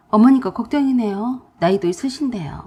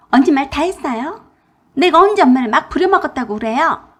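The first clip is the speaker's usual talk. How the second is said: higher, louder indignant retort; angry sister-in-law; 5 semitones higher, 7 dB louder than usual. Acted. Sister-in-law angrily snaps back at narrator. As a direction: snap back in offense.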